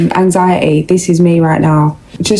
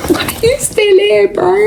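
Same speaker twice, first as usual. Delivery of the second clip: laughing disbelief; 14 semitones higher than usual. Retold or imitated. Laughs at ex's persistence.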